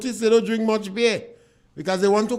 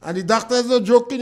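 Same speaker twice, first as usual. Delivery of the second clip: ordinary talk, nothing marked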